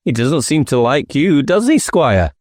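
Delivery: a hint of a sly detective poking fun; teasing inspector. Inspector teases squire.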